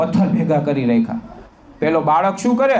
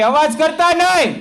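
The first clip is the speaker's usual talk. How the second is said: much higher, shouted classroom order; teacher's command; 9 semitones higher than usual. Retold or imitated. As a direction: bark it like a strict teacher. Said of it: "એના શિક્ષક એમ કહે કે".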